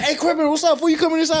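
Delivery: high, loud excited holler; hammed-up high-pitched greeting; imitated calling out. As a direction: imitate fans yelling to someone in a truck. Fans calling out passing the truck.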